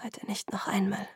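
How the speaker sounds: whispered silent plea; stummes Flehen. A narrator's reading. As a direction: beg under your breath, almost without voice. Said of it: "flehte ich stumm". (stumm flehend, geflüstert)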